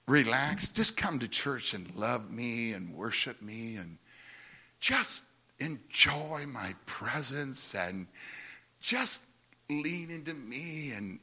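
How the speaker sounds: low, soft and slow, tender fatherly reassurance; hushed, calm divine voice; gentle voice of God. Retold or imitated. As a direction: speak it like a loving father soothing his son. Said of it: "This is what the message".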